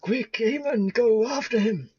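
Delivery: urgent shout. Real, not acted. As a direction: yell to send a friend after him. Urgent call to a companion.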